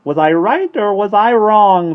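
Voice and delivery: high, loud, drawn-out bird screech; slow jeering squawk; taunting. A narrator's reading. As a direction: crow it in triumph. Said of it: "gleamed tauntingly at Annabel".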